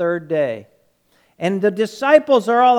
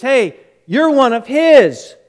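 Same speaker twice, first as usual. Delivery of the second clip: loud, higher accusation; accusing call; 5 semitones higher, 6 dB louder than usual. Retold or imitated. A man accusing Peter by the fire, loud accusation. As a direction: call him out loudly. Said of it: "a guy comes up, and he says".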